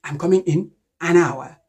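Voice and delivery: "an hour" with a clear N in "an"; corrected article. Shown as a demonstration. Teacher correcting the student, "an hour" with its N sounded. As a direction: say "an" with its N before silent-H "hour".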